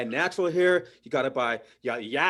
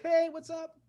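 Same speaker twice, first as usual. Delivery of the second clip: friendly neighbor; 11 semitones higher, 4 dB quieter than usual. Retold or imitated. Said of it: "someone will wave back like".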